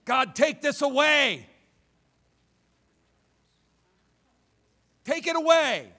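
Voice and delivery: loud, high cry to God; crying out in prayer; desperate plea. Acted. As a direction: beg God to take it away.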